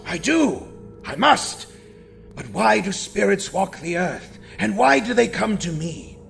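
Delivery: high, loud frightened answers; blurting out in panic; terrified reply. Acted. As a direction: Scrooge crying out in fear to the ghost. Terrified Scrooge answers ghost.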